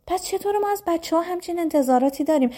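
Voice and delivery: normal talking voice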